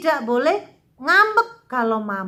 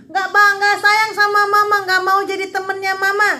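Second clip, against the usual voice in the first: put-on sulky child; 6 semitones higher, 5 dB louder, faster than usual. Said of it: "Kalau mama misalnya nggak belikan kue".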